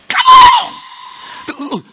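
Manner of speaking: very high, loud shout; rallying shout. Real, not acted. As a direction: yell them to their feet. Rallying the congregation.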